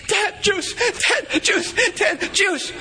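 put-on a pacing two-year-old shouting for juice; demanding toddler.

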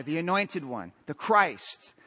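ordinary delivery

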